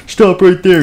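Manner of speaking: high, loud play-acted order; mock-heroic challenge; commanding shout. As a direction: shout it like a toy guard. Guard confronts the criminal, repeated.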